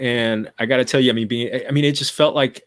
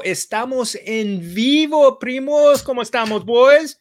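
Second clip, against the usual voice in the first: hype greeting; 10 semitones higher, slower than usual. Acted.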